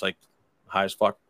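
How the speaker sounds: usual speaking voice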